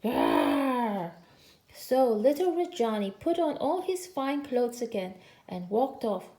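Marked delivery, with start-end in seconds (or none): 0.0-1.1 tiger growl. A narrator's reading. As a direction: growl like tigers with mouths full of tail. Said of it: "so they could only say".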